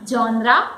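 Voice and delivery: GENRE as JON-ra, hard J, rising; wrong pronunciation. Shown as a demonstration. Teacher offering a wrong pronunciation as a question. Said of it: "No, the word is genre".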